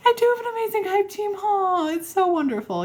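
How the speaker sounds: very high, cooing appreciation; real high-pitched "aw" gushing; touched gushing. Real, not acted. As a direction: coo it high, as if melting at their kindness. Gushing thanks to supportive viewers.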